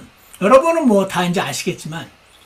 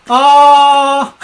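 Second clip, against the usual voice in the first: a loud, drawn-out, higher "ah" to drown out words; loud long ah; 6 semitones higher, 9 dB louder than usual. Shown as a demonstration. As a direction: hold a loud "ah" so you hear nothing. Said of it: "저는 원래 듣기 싫을 때 이렇게 합니다".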